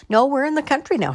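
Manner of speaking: high, fast, lively; brisk reassurance. Acted. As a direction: chirp it out quickly. Husband explaining, quick.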